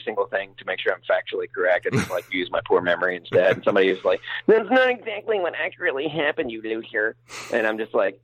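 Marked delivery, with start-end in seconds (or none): 4.5-7.1 high-pitched mock pedant voice; mocking nitpicker. Pedantic listener corrects the speaker's memory, mocking high voice. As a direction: mimic a know-it-all critic in a much higher voice. Mimics a nitpicking fact-checker.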